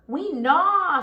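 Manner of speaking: put-on baby rats answer their mother; little rats. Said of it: "said the four".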